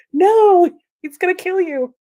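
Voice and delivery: high, loud pleading no; mock desperate cry. Rosamund begging him not to shoot, a loud mock plea.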